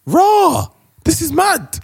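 high, incredulous exclamation; retelling a stunned first reaction; amazed reaction. Retold or imitated. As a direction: act shocked at the sight. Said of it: "And I was like".

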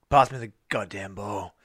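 usual speaking voice

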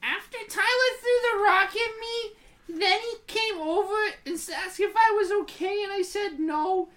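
put-on high, girlish kid-sister voice reading her diary; bratty kid sister reading her diary aloud; sister's voice. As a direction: read it as your little sister, whiny and dramatic. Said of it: "my sister's voice".

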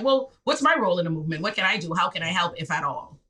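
ordinary talk

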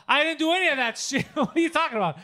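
mock-outraged denial; imitated indignant friend. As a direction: protest like your friend caught out, hammed up. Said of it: "this is him".

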